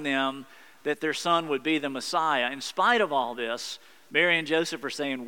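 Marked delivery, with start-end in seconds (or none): none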